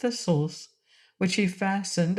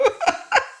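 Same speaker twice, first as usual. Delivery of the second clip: high giggle; 3 dB louder than usual. Acted. Fat king laughs.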